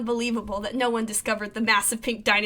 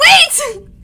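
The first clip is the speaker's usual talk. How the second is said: high, loud shout to stop him; 12 dB louder than usual. Acted. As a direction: yell it out to halt the rifle.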